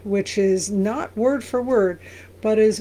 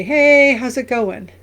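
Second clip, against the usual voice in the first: loud breezy hey, how's it going; casual greeting; 3 semitones higher, 6 dB louder than usual. Shown as a demonstration. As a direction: toss it off like greeting a buddy. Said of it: "saying it very informally".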